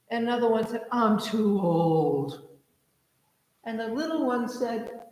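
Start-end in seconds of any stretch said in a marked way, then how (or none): 0.9-2.5 put-on slow weary old train. Retold old train refusal.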